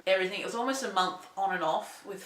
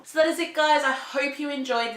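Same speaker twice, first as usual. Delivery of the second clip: suddenly much higher and louder, bright outro; upbeat sign-off; 7 semitones higher, 6 dB louder than usual. Real, not acted. Vlogger wrapping up the video for viewers, loud and upbeat. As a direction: snap into cheerful host mode.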